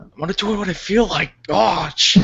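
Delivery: high-pitched, exasperated dork impression; Napoleon Dynamite. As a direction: put on a petulant teen-nerd voice. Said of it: "into Napoleon Dynamite".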